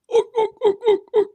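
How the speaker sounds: high, loud mock orc grunts; grunting orcs. Host imitates game orcs saying their name, loud mock grunts. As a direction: bark out orc, orc like a cartoon orc. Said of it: "they were going".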